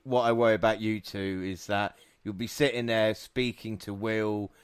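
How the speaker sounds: ordinary talk, nothing marked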